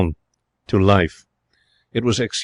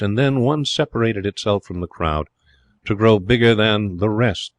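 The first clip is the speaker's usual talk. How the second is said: ordinary delivery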